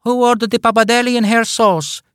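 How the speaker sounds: high, singsong waiter; chirpy waiter announcing dishes. Acted. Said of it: "asked the waiter".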